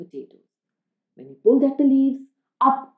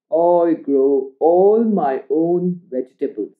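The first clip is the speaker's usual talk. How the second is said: slow, lower grandpa voice, proud; 4 semitones lower, slower than usual. Acted. Grandpa showing Oliver his garden, slow and proud. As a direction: boast slowly like an old grandpa. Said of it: "Grandpa said proudly".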